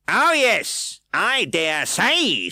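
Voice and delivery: high, loud, slow scornful drawl; sneer. A narrator's reading. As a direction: sneer at the excuse. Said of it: "with a sneer".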